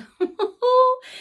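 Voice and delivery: high giggle; light, high storybook laughter; acted laugh. A narrator's reading. Sarah delighted at finding her bear, acted laugh. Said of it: "Sarah laughed".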